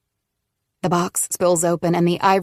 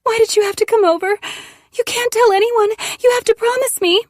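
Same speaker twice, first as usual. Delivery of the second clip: tearful pleading; 5 dB louder than usual. Acted. Continued tearful pleading as Eve.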